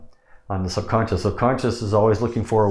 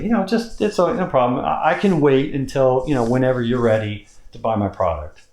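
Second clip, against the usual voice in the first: high pitch; 4 semitones higher than usual. Shown as a demonstration. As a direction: lift into a high easy tone. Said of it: "talk in a high pitch on this too".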